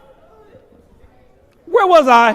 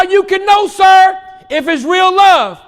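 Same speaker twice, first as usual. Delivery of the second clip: higher, louder, driving the point home; preaching at full force; 6 semitones higher, 4 dB louder, slower than usual. Acted. Preacher urging the church to know real love, full force. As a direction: build the sermon to its peak.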